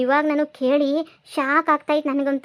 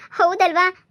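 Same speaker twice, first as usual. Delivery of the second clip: laughing; 5 semitones higher, 4 dB louder, much slower than usual. Acted. Wife mocks husband's quarrel.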